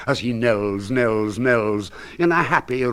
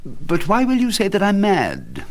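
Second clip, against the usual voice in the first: indignant protest; 10 semitones higher than usual. Acted. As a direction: voice jumps high in indignant protest. Narrator protests his sanity.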